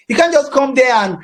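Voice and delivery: ordinary talk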